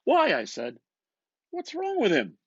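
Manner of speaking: McCoy buttonholing Bloom, loud and eager; McCoy's voice. Acted. McCoy retelling his own question.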